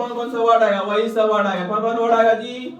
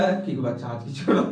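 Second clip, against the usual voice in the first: much lower, soft forgiving voice; indulgent mother; 9 semitones lower, 6 dB quieter than usual. Retold or imitated. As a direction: let the child off kindly. Mother letting child off. (माफ़ करती माँ की धीमी, नरम आवाज़)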